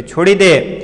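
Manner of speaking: a mother pleading loudly from a distance; mother calls to her son to drop the cub, loud and high; mother calling out. Acted. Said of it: "પોતાના બાળકને કહે છે કે".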